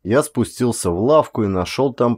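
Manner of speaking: plain reading voice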